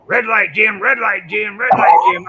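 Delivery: loud, high mock yell; yelling at himself. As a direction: imitate a racer hollering at himself mid-run. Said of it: "he's yelling at himself".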